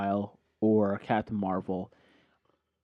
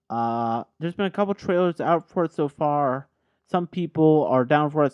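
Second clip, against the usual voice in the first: ordinary delivery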